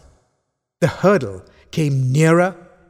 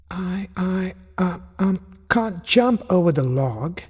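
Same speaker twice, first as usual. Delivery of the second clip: small, higher voice, haltingly tearful; tearful stammer; 6 semitones higher than usual. A narrator's reading. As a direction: stammer it out like a little one in tears. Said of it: "said Roma Rabbit slowly, in tears".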